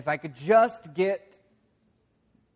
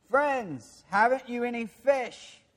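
calling out; 8 semitones higher, slower than usual. Acted. Jesus calling from shore.

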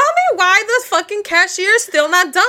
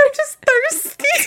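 higher, slow giggly quote; laughing; 9 semitones higher, 5 dB quieter, slower than usual. Retold or imitated. Quoting herself to a friend, higher and laughing. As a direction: squeak it out laughing.

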